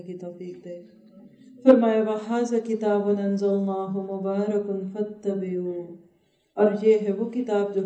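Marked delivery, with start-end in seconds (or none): 2.0-5.7 slow, measured Arabic recitation chant; unhurried Quran recitation in a new voice; Quranic recitation. Real, not acted. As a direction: intone the verse reverently and slowly.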